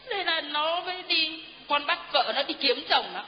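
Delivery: usual speaking voice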